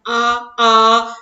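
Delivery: demonstrating the three-beat vowel; extra-long vowel. Shown as a demonstration. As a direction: prolong the आ like a call. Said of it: "और भी दीर्घ रूप से बताते हैं".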